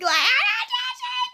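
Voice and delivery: squealed "judging", very high and drawn out; hammed-up high-pitched protest; mock disclaimer. Exaggerated repeat of disclaimer.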